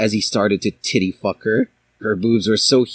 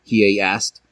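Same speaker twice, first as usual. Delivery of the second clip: ordinary delivery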